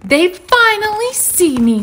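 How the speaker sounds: high, thrilled kitten voice; elated at being noticed; kitty's delighted thought. A narrator's reading. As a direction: marvel in a high little voice. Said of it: "thinks Kitty".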